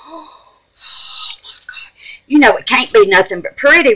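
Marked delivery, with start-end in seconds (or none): 0.1-1.9 breathless unvoiced wonder; awed whisper. Real, not acted. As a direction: whisper in delight at the colour. Gasp at the pretty colour.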